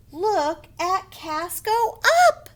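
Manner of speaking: high, loud, stretched-out exclamation; excited. Shown as a demonstration. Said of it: "we say it excited".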